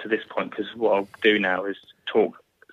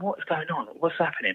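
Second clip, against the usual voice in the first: higher-pitched, softly voiced bewilderment; urgent questions, pitched up but held down; retold alarm; 7 semitones higher, 5 dB quieter than usual. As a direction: re-enact alarmed confusion without raising the volume. Retelling his alarm amid screaming.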